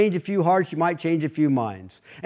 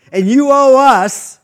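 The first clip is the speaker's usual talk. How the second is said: slow, loud, rising demand; mock official demand; 8 semitones higher, 8 dB louder, slower than usual. City official tells the bailiff she owes money, slow loud demand. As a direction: lean on it like a stern city official.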